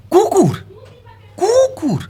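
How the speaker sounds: high, drawn-out, falling cry of the word; high-pitched offended repeat; indignant. Acted. Scornfully repeats the name given to dogs.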